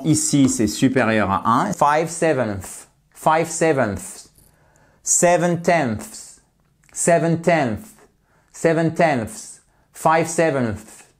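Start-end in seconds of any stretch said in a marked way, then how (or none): 1.7-11.1 "sevenths" and "tenths" drawn out slowly, final th-s sounds stressed hard; slowly over-pronounce the "th" plus "s" endings; exaggerated plural endings. Shown as a demonstration. Teacher drilling French learners, slow over-articulated "sevenths". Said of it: "j'exagère sur le pluriel".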